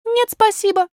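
put-on very high, polite child's voice; little princess. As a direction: decline primly as a small girl. Little princess declines the offer.

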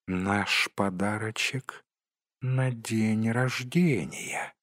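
soft, slow creature murmur; Gollum. A narrator's reading. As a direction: croon it quietly to yourself. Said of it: "любил повторять Голлум". (тихий протяжный голос Голлума)

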